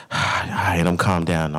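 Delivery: ordinary delivery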